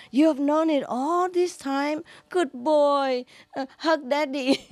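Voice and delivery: high, delighted fatherly cry; overjoyed postman. Acted. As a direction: gush over the boy with joy. Postman hugging boy, overjoyed.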